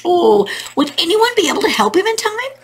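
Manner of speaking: mild storybook fretting; worried wondering. Acted. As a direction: wonder anxiously for the little rooster. Rooster's worried pacing, narrated.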